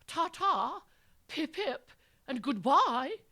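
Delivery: high, loud hammed-up British accent. A narrator's reading. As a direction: bid farewell in a showy British accent. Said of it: "in his rapt audience ... best British accent".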